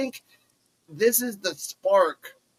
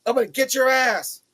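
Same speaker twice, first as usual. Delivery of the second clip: higher mock threat; imitating Kendall; 9 semitones higher than usual. Threatening Wendy in the retold promo, higher mock taunt. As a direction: toss out a cocky threat in a higher voice. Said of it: "And she says that".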